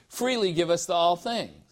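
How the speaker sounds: ordinary delivery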